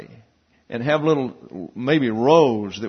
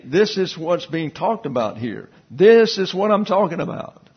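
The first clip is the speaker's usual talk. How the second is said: usual speaking voice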